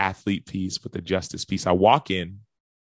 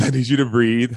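louder, higher, insistent command; urgent instruction; 5 semitones higher, 9 dB louder than usual. Retold or imitated. Counselor urging gasping client to breathe, urgent instruction. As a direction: cut through the gasping firmly. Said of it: "he says".

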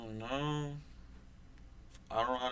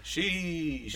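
half-hearted high sheesh, short of falsetto; drawn-out but underpowered meme cry; imitated failed sheesh; 7 semitones higher than usual. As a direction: mimic someone who can't reach the pitch. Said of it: "can't do it at all".

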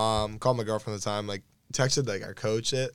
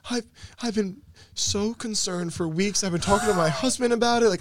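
imitated tearing up; 9 semitones higher, 4 dB louder than usual. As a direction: imitate a teacher on the verge of tears. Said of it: "Starts, like, tearing up".

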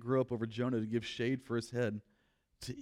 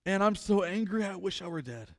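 whining Jonah; 6 semitones higher, 4 dB louder than usual. Acted. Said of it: "He whines".

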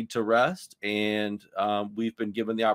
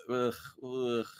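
soft "ugh" of disgust; queasy, reluctant groaning; 8 dB quieter than usual. Real, not acted. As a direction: groan as if it turns your stomach. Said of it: "that makes me sick to say that".

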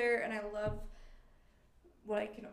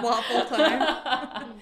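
slow, louder, laughing drawl; playful drawl; 12 dB louder, much slower than usual. Real, not acted. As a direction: stretch it out teasingly. Echoing friend's phrase as a joke.

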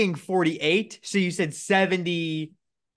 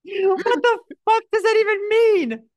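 laughing; 5 dB louder than usual. Real, not acted. Laughing hysterically at friend's math.

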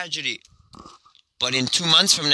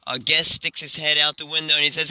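ordinary delivery

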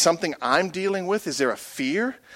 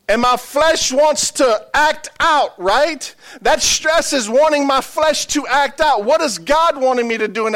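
slow, louder, higher preaching build; 7 semitones higher, 4 dB louder, slower than usual. Acted. Pastor preaching to congregation, slow and louder. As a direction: drive each phrase slowly home.

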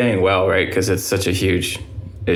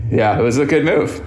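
laughing; 5 semitones higher than usual. Real, not acted. Laughing at his childhood self.

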